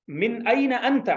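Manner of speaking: slow, drawn-out Arabic phrase, each word set apart; teacher models मिन अइन अंत slowly for students; slow model reading. Shown as a demonstration. Slow teacher demo. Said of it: "देखें मिन का मतलब है से".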